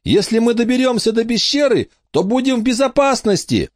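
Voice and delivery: put-on high boy's voice yelling into the storm; shouting over wind. A narrator's reading. Michael keeps shouting in storm.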